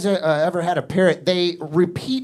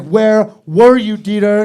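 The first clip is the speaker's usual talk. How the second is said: angry wife; 4 semitones higher, 9 dB louder, slower than usual. Retold or imitated. Angry wife confronts husband.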